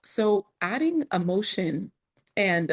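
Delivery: usual speaking voice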